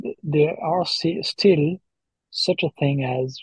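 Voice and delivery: ordinary talk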